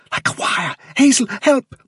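high, strained cry for help; panicked squeal. A narrator's reading. Fiver calling Hazel while in terror, panicked. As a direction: cry out as if caught in a snare. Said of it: "He squealed and rolled over".

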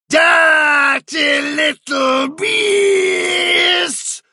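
high, drawn-out unnatural howl; yelped. A narrator's reading. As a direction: wail it out in a possessed shriek. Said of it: "yelped Fiver".

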